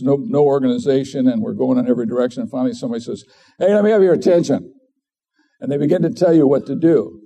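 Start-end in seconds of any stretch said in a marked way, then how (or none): 3.6-4.7 higher, quicker call for attention; voice of authority. Acted. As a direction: call a milling group to order. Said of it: "That is a voice of authority".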